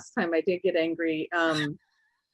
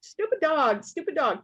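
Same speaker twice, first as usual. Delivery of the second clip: higher angry grumble; freaking out; 7 semitones higher than usual. Retold or imitated. Son fuming at the dog that spilled water, mock angry. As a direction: curse the dog. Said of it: "he's freaking out".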